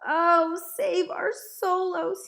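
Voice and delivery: high, very loud drawn-out wail; furious, anguished cry. A narrator's reading. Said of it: "voice was filled with fury and anguish".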